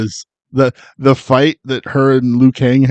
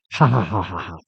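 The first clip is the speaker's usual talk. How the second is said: Raiden's weird laugh; 5 semitones lower, 8 dB quieter, faster than usual. Retold or imitated. Mimicking how the actor laughs, fake breathy chuckle. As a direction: imitate a strange laugh, petering out. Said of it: "And he goes like".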